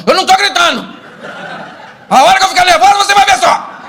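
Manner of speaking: high, loud hammed-up shrieking wife; acted high-pitched screaming tirade; yelling wife. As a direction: yell in denial as the angry wife. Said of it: "começa a aumentar o volume, mas não nota".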